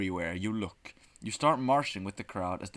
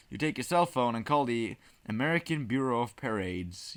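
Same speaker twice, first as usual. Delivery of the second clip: ordinary talk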